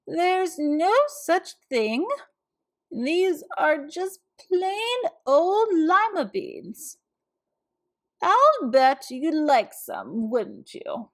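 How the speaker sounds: put-on a sweet old lady tutting over a case; gentle old woman voice; kind old woman. A narrator's reading. Old woman offers beans.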